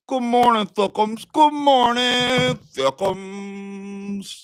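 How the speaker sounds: high, slow sing-song hello; a playful high crooned greeting. Pretend. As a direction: croon a cutesy morning greeting.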